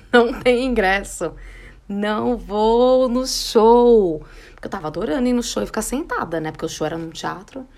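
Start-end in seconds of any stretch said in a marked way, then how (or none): 0.1-4.2 slow, drawn-out words through laughter; laughing, half-guilty joy; laughing relief. Retold or imitated. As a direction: giggle out the secret relief. Relief that tickets sold out.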